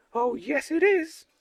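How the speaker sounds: put-on high, bratty girl voice; high mischievous girl; Uliana's voice. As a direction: pitch it way up like a cheeky kid. Uliana taunts Semyon.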